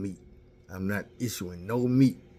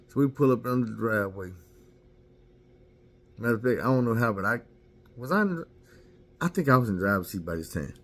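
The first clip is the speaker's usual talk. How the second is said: ordinary delivery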